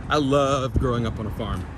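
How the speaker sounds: ordinary talk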